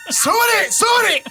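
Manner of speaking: high shouted battle cry; samurai voice. Pretend. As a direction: bellow it like a warrior charging.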